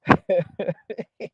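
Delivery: real chuckling laugh. Real, not acted. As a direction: laugh it off, amused. Amused after the name joke.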